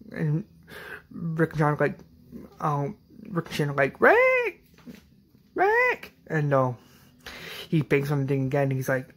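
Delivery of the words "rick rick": very high-pitched mock shout; calling out. Rick and Shane scene retold, high mock shouts. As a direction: imitate calling a friend's name, high and strained. Two men call out to Rick.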